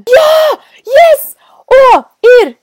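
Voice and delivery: high, loud relieved shout; relieved cheer. Real, not acted. Streamer shouts when she finally finds a bush, high loud cheer. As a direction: shout out in triumph.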